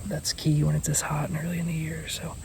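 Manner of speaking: hushed hunting-stand whisper. Real, not acted. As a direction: keep it whispered so the deer don't hear.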